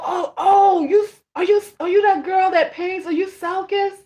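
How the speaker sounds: imitating a starstruck stranger in the street; high-pitched, halting burst of recognition; excited fan. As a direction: mimic someone spotting a local celebrity. Acts out fans recognizing her.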